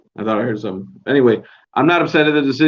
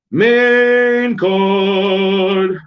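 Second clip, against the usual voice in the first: a hint of a ring announcer, higher and louder; 6 semitones higher, 4 dB louder than usual. Introducing the main card to viewers, mock announcer call.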